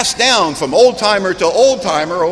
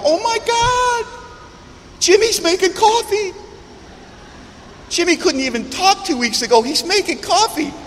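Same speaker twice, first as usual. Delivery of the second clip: amazed; 13 semitones higher than usual. Retold or imitated. Old-timer amazed at the newcomer making coffee, very high. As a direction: marvel at the newcomer. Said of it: "and you'll go".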